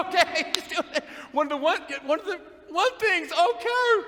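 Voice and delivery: high, loud jeering voice; mocking guy. Church guy mocking her one-of-those business, high jeer. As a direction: squeal the mockery.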